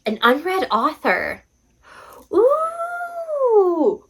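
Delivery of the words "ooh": long, very high delighted ooh; high excited ooh. Real, not acted. Vlogger thrilled by her prompt pick, high ooh. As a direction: coo with real delight.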